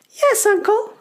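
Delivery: very high little goblin voices; goblin children. Acted. Goblin children answering their uncle, high childlike voice. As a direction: chirp it obediently, high. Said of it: "say the goblins".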